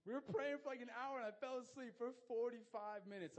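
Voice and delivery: loud, high exclamation; incredulous confession. Real, not acted. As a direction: confess loudly, amazed at yourself. Confessing embarrassing nap during prayer.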